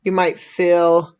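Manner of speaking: calm, unhurried meditation voice with long pauses; soothing, measured invitation to breathe and settle; guided meditation. Real, not acted. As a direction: gently guide listeners into relaxing, leaving space after each phrase.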